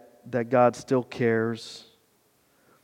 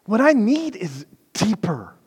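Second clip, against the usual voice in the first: ordinary talk, nothing marked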